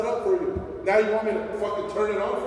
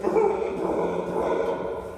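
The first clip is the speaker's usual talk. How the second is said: high vocal stereo effects; imitated stereo noise; 13 semitones higher than usual. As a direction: mimic a blaring sound system. Imitates stereos heard everywhere.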